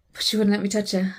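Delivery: ordinary delivery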